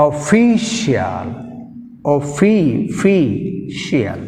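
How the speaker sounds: official, slow, stress on fi; stress demo. Shown as a demonstration. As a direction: drag out official, punch fi. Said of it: "ఫీ, second syllable". (ఫీ మీద ఒత్తిడి, నెమ్మదిగా)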